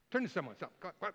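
normal talking voice

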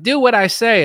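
high, loud imitation of an attacker; exaggerated pushy demand; mock bossy order. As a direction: bellow a command in a hammed-up voice. Voices attacker giving orders.

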